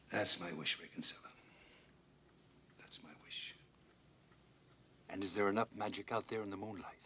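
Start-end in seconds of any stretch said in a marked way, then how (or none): 2.8-3.5 much softer, almost whispered; hushed wistful repeat. Acted. Old ballplayer confiding his wish to Ray Kinsella, hushed. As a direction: let the wish fade into a whisper.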